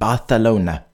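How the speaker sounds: [name] said Barthelona, th sound for the c; [name] with a Spanish th, Barthelona; [name] with a lisp. Shown as a demonstration. Hosts naming the song, [name] with a Spanish th. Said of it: "It is actually [name] with T H".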